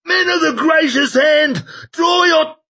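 put-on high, loud old man's yell; rally the men, bellowing high; drunken old man shouting. A narrator's reading. Koska's rallying cry.

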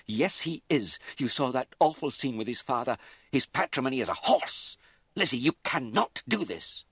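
high, loud, relentless anger; rage. A narrator's reading. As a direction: hammer each point in fury. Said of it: "Mother was possessed by rage".

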